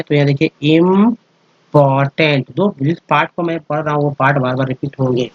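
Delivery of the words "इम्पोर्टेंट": इम्पोर्टेंट drawn out slowly; slow pronunciation demo. Shown as a demonstration. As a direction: say इम्पोर्टेंट slowly, ending on tent. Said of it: "TNT को tent बोलते हैं". (इम्पोर्टेंट धीरे-धीरे)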